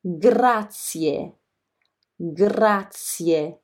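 "Grazie" drawn out with each vowel sounded, final i-e distinct; every vowel pronounced. Shown as a demonstration. Teacher models thank you for learners, every vowel in "Grazie" sounded. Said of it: "you should pronounce every single vowel".

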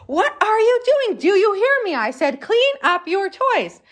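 high, exasperated scolding; a bossy sister losing patience, then slowing to order him; worked-up sister. Retold or imitated. Teacher voicing the sister scolding her brother over toys, high and exasperated. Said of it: "she's getting so worked up".

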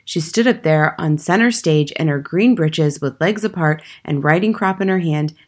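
ordinary delivery, nothing marked

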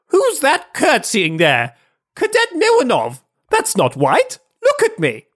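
high, sneering officer voice; mocking officer voice. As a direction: jeer at the cadet in a high put-on voice. Denisov mocking cadet, high put-on voice.

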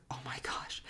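breathless whispered oh my gosh; hushed, unvoiced dismay; whispered panic. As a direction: whisper it, stunned. Panicking at her move-out date.

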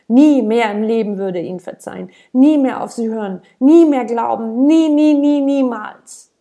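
furious vow, climbing; building outburst. A narrator's reading. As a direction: hammer every "nie", rising to a cry. Narrating child's furious vow.

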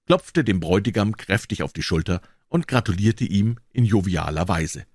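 normal talking voice

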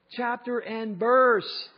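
loud, high, drawn-out call down the hallway; retold shout. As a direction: shout it slowly, stretching the last word. Shouted retort during hallway quarrel.

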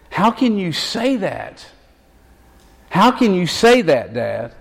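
pleading disbelief, rising high then dropping; a son who cannot believe his father's words; incredulous protest. Acted.

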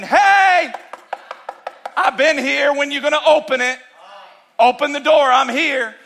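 loud, high call of Jesus at the door; Jesus calling through the door, high and loud; voicing Jesus. Pastor voices Jesus knocking.

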